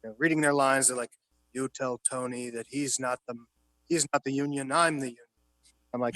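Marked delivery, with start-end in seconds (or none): 1.5-5.2 low, hushed run-through of the line; quiet rehearsal read. Retold or imitated. Retelling how Pacino quietly rehearsed his line with De Niro, softly. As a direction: murmur it like actors rehearsing at a table. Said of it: "they're quietly rehearsing".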